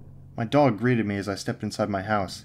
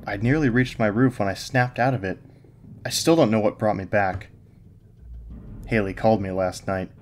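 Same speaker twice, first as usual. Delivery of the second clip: ordinary delivery, nothing marked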